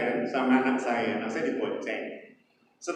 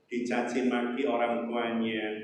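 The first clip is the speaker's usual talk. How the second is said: ordinary talk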